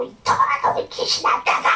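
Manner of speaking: strained, breathy re-enacted cry; shouted exorcism command. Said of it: "막 소리 지르면서 막 외치면서".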